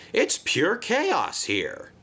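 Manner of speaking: ordinary delivery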